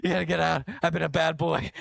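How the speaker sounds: mock frantic whisper-plea; frantic pleading. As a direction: hurry him out flustered. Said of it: "I'm like".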